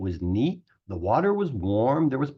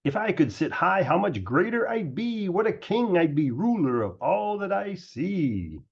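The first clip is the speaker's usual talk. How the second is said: a lightly raised, dreamy king's voice; proud turtle king; 6 semitones higher than usual. A narrator's reading. Yertle musing to himself about a higher throne, grand.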